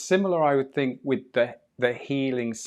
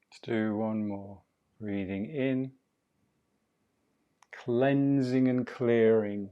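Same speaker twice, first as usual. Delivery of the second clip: guided breathing; 8 dB quieter than usual. Acted.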